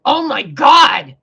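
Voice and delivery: slow, loud, high oh my God; exasperated shout. Real, not acted.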